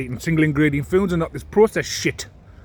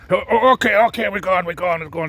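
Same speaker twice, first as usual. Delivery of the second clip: high, louder flustered mock that fades as she backs off; flustered retreat mocked; 5 semitones higher, 4 dB louder than usual. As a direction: yelp then fade like someone hurrying out.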